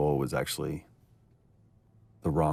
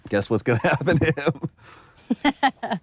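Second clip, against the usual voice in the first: laughing tease; 12 semitones higher, 7 dB louder, much slower than usual. Real, not acted. Teasing about character's coming fate.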